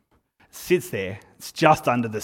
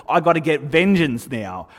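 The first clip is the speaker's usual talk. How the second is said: loud, indignant retelling; louder, self-righteous mock outrage; fired up; 3 semitones higher, 8 dB louder than usual. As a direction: act out being worked up on court. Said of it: "I get all fired up".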